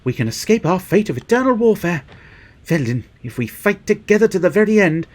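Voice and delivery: high earnest hero voice; rally the team, high and earnest; heroic game character. Acted. Reads heroic character lines.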